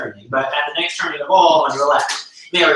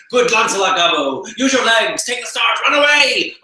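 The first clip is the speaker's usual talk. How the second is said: tempting fiend; 8 semitones higher, 4 dB louder than usual. Acted. Fiend tempting him to flee.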